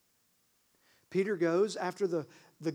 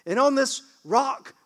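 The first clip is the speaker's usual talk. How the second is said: ringing proclamation; 5 semitones higher, 10 dB louder than usual. Acted. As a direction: proclaim it with full force. Jesus declaring the church's foundation.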